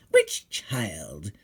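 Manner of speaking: low, scheming witch voice; a greedy witch eyeing her prey; witch muttering to herself. A narrator's reading. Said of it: "she says to herself".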